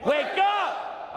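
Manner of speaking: high shouted wake-up call; booming motivational speech. Acted. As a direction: shout it to the crowd. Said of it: "Say it again".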